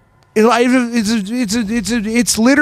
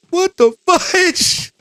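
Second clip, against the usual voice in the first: shocked squeaky voice; 12 semitones higher, much slower than usual. Acted. Mock reaction to creepy line.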